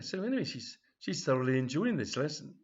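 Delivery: ordinary talk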